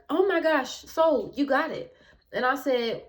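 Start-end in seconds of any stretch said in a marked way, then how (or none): 0.1-2.0 bright, higher and louder eager sale pitch; imitating a thrilled realtor. Realtor closing the sale with the narrator, eagerly high and loud. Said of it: "the realtor is like".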